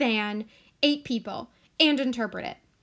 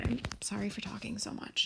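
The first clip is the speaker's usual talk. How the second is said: whisper; 2 semitones lower, 10 dB quieter than usual. Real, not acted. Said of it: "whispered apology".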